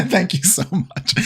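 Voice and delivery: high giggling thanks; laughing. Real, not acted. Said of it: "enough, enough".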